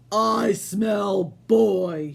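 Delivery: very slow, loud sniffing giant shout; giant's voice. Acted. Giant sniffing for Jack, slow booming shout. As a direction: drag it out like a hungry giant. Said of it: "he said".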